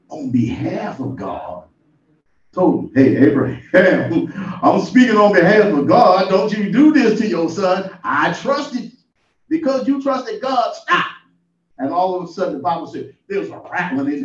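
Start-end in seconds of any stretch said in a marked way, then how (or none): 2.9-11.0 louder, laughing call that rises to a high stop; angel's voice. Retold or imitated. Lightly voiced angel stopping Abraham, rising to a cry. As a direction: call out like a heavenly messenger. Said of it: "the voice of the angel".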